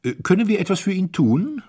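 high, loud, fatuously polite; idiotic amiability. A narrator's reading. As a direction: offer help with vacuous kindliness. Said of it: "mit der blödsinnigen Liebenswürdigkeit". (blödsinnig liebenswürdig)

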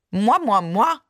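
pursed-lip mock-French preening; affected French airs. A narrator's reading. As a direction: say it loudly with pouted lips, chin in the air. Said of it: "mit erhobenem Kinn und gespitzten Lippen".

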